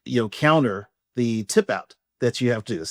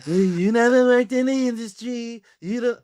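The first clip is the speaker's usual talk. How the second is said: exaggerated whiny mimicry, pitched way up; mocking imitation; 10 semitones higher than usual. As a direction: throw the teasing impression back, way overdone. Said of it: "I don't talk like that at all".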